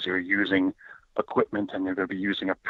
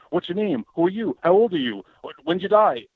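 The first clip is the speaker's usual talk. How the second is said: higher, rapid-fire mock interrogation; interrogating; 8 semitones higher, 4 dB louder than usual. Imitating a rude investigator quizzing a ghost, mock interrogation. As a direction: fire off questions like a pushy cop. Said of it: "I'm like".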